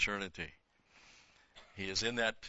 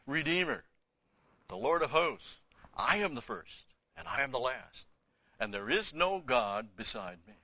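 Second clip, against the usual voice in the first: raised, declaiming reading of the verse; proclaiming scripture; 3 semitones higher than usual. Acted. Bible teacher reads Isaiah aloud, proclaiming. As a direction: read it out like God speaking.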